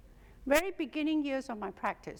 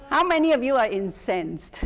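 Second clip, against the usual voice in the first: ordinary delivery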